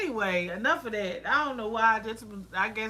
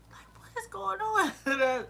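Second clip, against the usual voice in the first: high, breathless whisper of disbelief; incredulous whisper; 10 semitones higher, slower than usual. Retold or imitated. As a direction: whisper it, stunned by the nonsense. Retold frustration.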